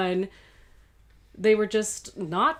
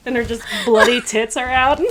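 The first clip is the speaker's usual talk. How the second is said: ordinary delivery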